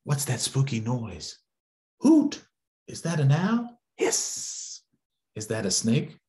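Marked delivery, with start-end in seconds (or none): 2.0-2.4 very high, loud hoot; owl hoot. Acted. Reader makes the owl sound for the kids, a high loud hoot. 4.0-4.8 snake hiss. Acted. Snake noise in story.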